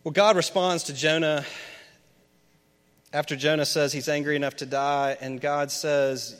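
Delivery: normal talking voice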